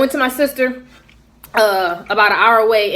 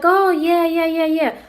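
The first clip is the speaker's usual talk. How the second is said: higher, lightly put-on store clerk; clerk's voice; 7 semitones higher, slower than usual. Storyteller voicing the beauty store clerk, high and eager.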